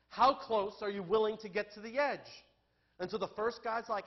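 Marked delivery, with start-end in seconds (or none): 0.1-2.5 raised, louder voice for a test question; acted challenge. Rich man testing would-be chauffeurs, loud acted challenge. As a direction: put the question out high and loud.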